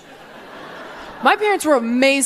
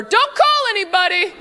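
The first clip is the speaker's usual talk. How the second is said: mock breezy parent; 9 semitones higher than usual. Parent covering up.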